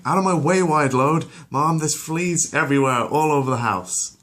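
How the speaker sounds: high, loud, exasperated; impatient Meg. Acted. Meg complaining to mom, high and exasperated. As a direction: shove past and complain. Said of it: "Meg's patience is also dwindling".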